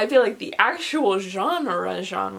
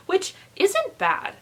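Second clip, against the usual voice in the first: high, slow satisfied drawl; slow, higher self-approving; smug drawl; 11 semitones higher, slower than usual. Real, not acted. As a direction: stretch it out, pleased with herself.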